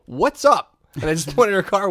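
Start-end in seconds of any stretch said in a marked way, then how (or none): 0.1-0.8 louder, higher, insistent challenge; changed, pointed tone. Retold or imitated. Pointing at her badly parked car, changed to a pointed tone. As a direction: punch it out as a demand. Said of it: "I kinda changed my tone and I point at it".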